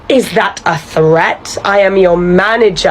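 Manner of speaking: ordinary talk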